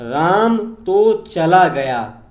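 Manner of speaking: तो stressed, slow and loud. Shown as a demonstration. Teacher stressing the particle for students, slow and loud. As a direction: lean hard on तो in Ram तो चला गया. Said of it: "तो पर बल दिया गया है".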